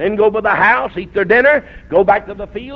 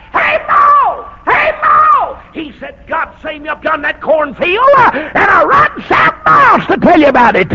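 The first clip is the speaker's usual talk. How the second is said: high, loud yell, holding nothing back; hollering; 10 semitones higher, 6 dB louder than usual. Retold or imitated. Son yells the news to his mother in church, high loud hollering. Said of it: "hollering to the top of his voice".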